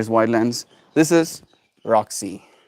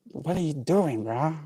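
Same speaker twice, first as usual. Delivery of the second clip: higher, softer whiny pet voice; jealous pet voice; 7 semitones higher, 5 dB quieter than usual. Pretend. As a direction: whine as the jealous dog. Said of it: "she's like".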